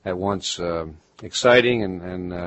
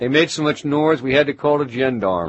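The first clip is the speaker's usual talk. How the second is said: louder, higher mock-Baltimore voice; Baltimore accent; 7 semitones higher, 7 dB louder than usual. Imitating a local complaining about noise, Baltimore accent. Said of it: "the way they talk down there".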